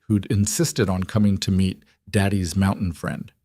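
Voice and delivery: ordinary delivery, nothing marked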